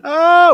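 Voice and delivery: high, loud mock scream; hammed-up falling scream. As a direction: imitate two people screaming as they fall. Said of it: "Gary's screaming".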